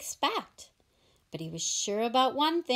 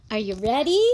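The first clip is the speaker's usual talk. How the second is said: calling out; 7 dB louder, faster than usual. Acted. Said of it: "Cake called from his bathroom".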